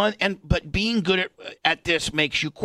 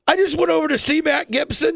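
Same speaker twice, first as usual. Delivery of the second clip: put-on high, whiny kid's wail; hammed-up weepy child; mock crying; 13 semitones higher than usual. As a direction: blurt a kid's excuse in a high, tearful voice. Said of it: "you're crying like that".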